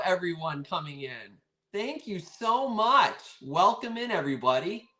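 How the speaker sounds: normal talking voice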